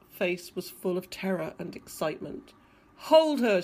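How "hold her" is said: short, loud, raised command; shouted. A narrator's reading. Father ordering his son to hold the mermaid, shouted. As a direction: call out an urgent order. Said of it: "shouted the father".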